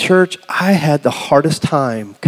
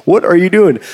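raised, louder puzzled question; bewildered; 5 semitones higher, 5 dB louder than usual. Retold or imitated. Preacher imitating his mom on the phone, bewildered. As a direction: imitate a baffled mother. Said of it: "the bewilderment on my mom's voice".

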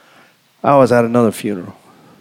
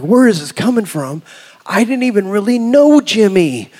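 higher, pleading disbelief; bewildered protest; 7 semitones higher than usual. Retold or imitated. Preacher reliving unexpected tears at the graveside, bewildered. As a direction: protest in rising bafflement.

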